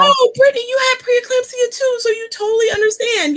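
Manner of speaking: very high-pitched, delighted outburst; excited recognition. Real, not acted. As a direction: exclaim it high as you suddenly find a fellow sufferer. Reacting to Brittany's message in chat.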